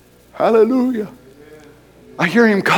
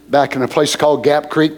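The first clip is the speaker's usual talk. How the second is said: ordinary talk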